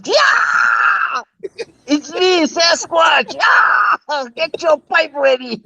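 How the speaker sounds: high-pitched screamed impression of a wild caller; Sasquatch scream. Co-host mimics a caller who startles drivers, screaming. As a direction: burst in with a startling high yell. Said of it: "he'll let out a scream".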